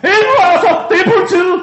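high, loud shouting cop; police shout. Acted. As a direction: bark the raid order. Police raid bursts in.

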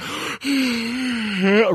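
high exaggerated yawn gasp; mock loud yawn. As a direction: imitate a yawn right into the mic. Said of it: "you're always like".

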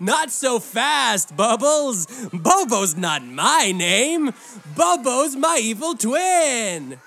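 a loud, playful clown host; singsong puppet show host. Acted. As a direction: tease the kids like a party clown. Clown hosting kids' party.

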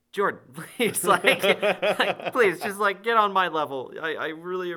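pleading. Retold or imitated. Begging actor not to risk stunt.

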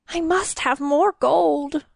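slow, high craving king; greedy king voice. A narrator's reading. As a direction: crave the gold slowly. King craves gold.

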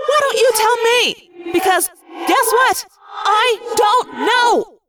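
high, loud, tense snapping back; exasperated outburst. A narrator's reading. Rachel asked what she is morphing, exasperated outburst. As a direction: fling it back, drawing out the last words.

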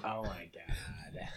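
breathy unvoiced whisper; whispered exclamation. Real, not acted. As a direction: whisper it in disbelief. Reacts to mobile game.

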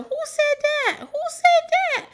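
very high, loud mock challenge; very Portia. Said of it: "It was very Portia".

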